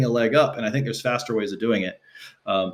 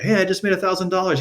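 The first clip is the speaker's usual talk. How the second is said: bright, fast, higher boast; excited brag; 8 semitones higher, faster than usual. Retold or imitated. Son calls dad to brag about money, excited. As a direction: tell dad the big news, pitched up. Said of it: "I was like".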